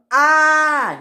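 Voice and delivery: loud high "ah!"; high acted yell; scream. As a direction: scream as you're flung into the air. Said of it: "he screamed".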